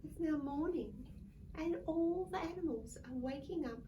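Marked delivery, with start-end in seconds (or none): none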